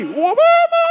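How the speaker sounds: long falsetto wail, acted; child's wailing call. Boy running alone to his mother, acted high wail. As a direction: wail for mum while running to her.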